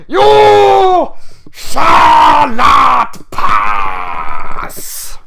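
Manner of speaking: very slow, high, loud mock wizard bellow; wizard yell. Gandalf yelling his famous line, hammed-up slow shout. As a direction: drag out a hammed-up wizard roar. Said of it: "yell when he yelled".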